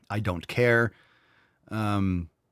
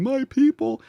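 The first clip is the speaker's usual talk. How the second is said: very high, loud fake wail; mock crying; 5 dB louder, slower than usual. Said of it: "when she was crying".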